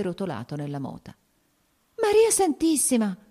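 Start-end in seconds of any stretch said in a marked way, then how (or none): 2.0-3.2 a high, loud cry of alarm; shocked exclamation. A narrator's reading. Wife cries out in alarm at her soaked husband. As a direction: gasp it out, hands clasped in dismay. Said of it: "esclamò ella giungendo le mani".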